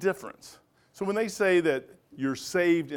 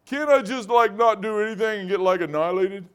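higher, whiny mock coworker; 5 semitones higher, 3 dB louder than usual. Steve's coworker asking to skip everything, higher mock whine. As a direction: lampoon the lazy coworker's plea. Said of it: "He goes".

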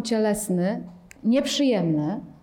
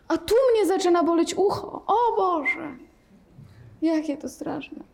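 mock fright; 7 semitones higher than usual. Teacher parodies a student's aches, mock alarm. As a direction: groan about every ache. Teacher voicing panicked meditator.

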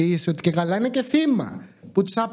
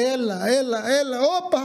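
retold shouting directions; 5 semitones higher than usual. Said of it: "να φωνάζει ο ρούλος".

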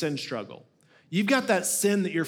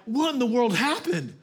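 a higher, stunned "what happened"; shocked; 6 semitones higher than usual. Retold or imitated. Preacher finding his gouged yard, stunned disbelief. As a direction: gasp at the ruined yard. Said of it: "And I'm like".

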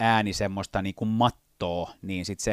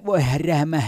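comic voice; 6 semitones higher, 5 dB louder than usual. Retold or imitated. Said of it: "tai, tai".

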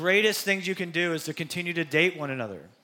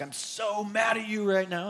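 higher, mock-upset child voice; imitating a sulky kid, pitched up; mock mad kid; 4 semitones higher than usual. Kid tells parents off, lightly played mock anger. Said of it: "our kids would often say to us".

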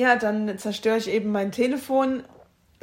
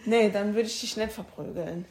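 ordinary talk

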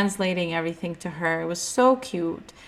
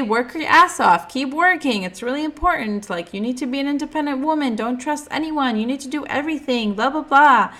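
higher, brisk grandma lecture; lecturing grandma; 6 semitones higher, 4 dB louder than usual. Retold or imitated. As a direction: rattle off grandma's life rules. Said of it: "She would always say".

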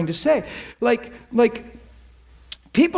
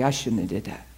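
"I shouldn't have did that" dropped low and much softer; softer, lower "I shouldn't have did that"; quiet admission; 11 semitones lower, 7 dB quieter than usual. Retold or imitated. Girl corrects herself, regretful.

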